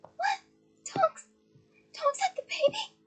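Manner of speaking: very high squeaky shriek of disbelief; high-pitched astonished girl's shriek; shrieked surprise. Acted. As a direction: squeal it like you can't believe it. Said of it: "Hermione shrieked".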